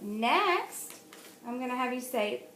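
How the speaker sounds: ordinary talk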